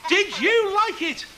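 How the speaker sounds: slow, high, loud call; rousing shout. Acted. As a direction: stretch it out to rouse the kids. Third asking, louder for children.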